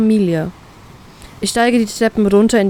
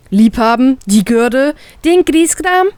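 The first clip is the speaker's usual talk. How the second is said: louder, outraged questions rising high at the end; indignant, louder, climbing higher; indignant grumbling; 4 semitones higher, 4 dB louder, slower than usual. A narrator's reading. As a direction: protest in disbelief. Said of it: "motzen wir sie gleichzeitig an".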